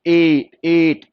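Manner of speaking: teacher drilling the long vowel; very slow drawn ए. Shown as a demonstration. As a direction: stretch every sound. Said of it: "इसकी sound क्या आ रही है?".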